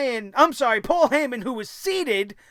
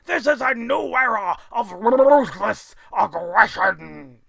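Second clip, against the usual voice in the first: bombastic mock boss with a big forced laugh; hammed-up, gloating tyrant proclaiming his verdict; Vince McMahon; 3 dB louder than usual. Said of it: "Going up to Vince McMahon".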